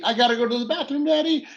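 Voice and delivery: put-on high, fast, whiny child voice; whiny kid. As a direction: beg like a kid in the back seat. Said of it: "the kids are yelling from the back seat".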